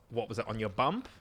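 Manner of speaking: ordinary delivery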